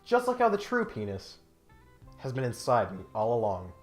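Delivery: ordinary talk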